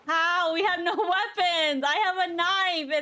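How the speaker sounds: loud, laughing alarm; panicked laughing shout. Real, not acted. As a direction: yell in half-laughing panic. Panic about wither.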